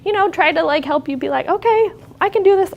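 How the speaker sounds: very high-pitched acted reassurance; lightly acted, high encouraging quote; retold reassurance. Nurse retells reassuring a patient.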